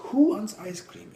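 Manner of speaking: put-on high, loud cheery call to the kids; a dad calling out a treat; excited call. Dad calls kids for ice cream.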